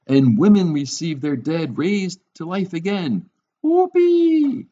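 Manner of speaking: hammed-up delight. Preacher reads the triumphs of faith to the congregation, exaggeratedly delighted. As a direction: celebrate it like a thrilled fan.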